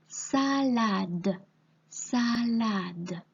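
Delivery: "salade" slow, as sa-lade; dictated syllables. Shown as a demonstration. Teacher dictating the second word, slow syllables. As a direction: draw out both syllables of "salade". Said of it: "tu vas essayer d'écrire".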